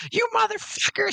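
high shriek in a woman's voice; retelling a movie, a woman yells at NPH, high mock shriek; shrieking mock insult. Woman yells in retold scene.

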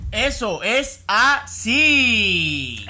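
aguda, fuerte y muy lenta; voz de bebé. Acted. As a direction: estira la frase como un bebé. Voz de bebé otra vez. (voz de bebé)

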